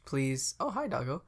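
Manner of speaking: ordinary talk, nothing marked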